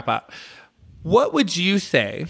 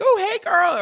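put-on high-pitched, gushing hey-girl; excited squeal of hello; excited greeting; 14 semitones higher than usual. Shown as a demonstration. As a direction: greet them way up high, thrilled. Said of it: "it could be excited".

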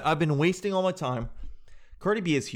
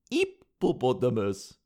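slow French-accented imitation; 2 semitones lower, slower than usual. Host mimics the French girl after laughing, French accent. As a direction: stretch out a French-accented attempt at American. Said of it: "French girl trying to do an American accent".

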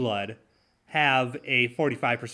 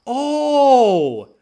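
a high, loud, scoffing "Oh"; sarcastic oh; 11 semitones higher, 11 dB louder than usual. Acted. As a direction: scoff at the claim, mock surprise. Sarcastic outburst at a claim.

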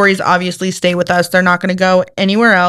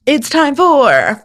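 announcer voice; 6 semitones higher, much slower than usual. Pretend. Announcing the game segment.

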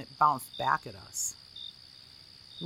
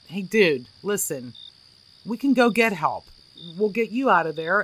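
calling out; 3 semitones higher, 7 dB louder than usual. A narrator's reading. Jeff promises the trapped man help, calling loudly. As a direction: call the promise across to him.

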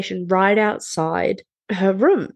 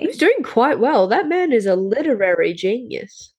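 normal talking voice

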